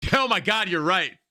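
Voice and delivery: high, loud burst of amazement; sudden realisation. Real, not acted. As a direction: exclaim as if it just hit you.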